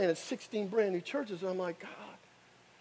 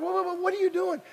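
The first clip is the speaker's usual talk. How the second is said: alarmed; 12 semitones higher, faster than usual. Retold or imitated. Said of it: "And I'm like".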